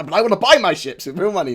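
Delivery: mock a whining fan; whiny complainer. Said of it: "Some people".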